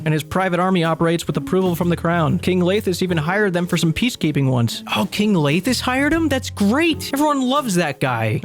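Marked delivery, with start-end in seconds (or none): 5.0-8.4 high mock delight, clearly sarcastic. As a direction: gush with fake excitement. Mock enthusiasm.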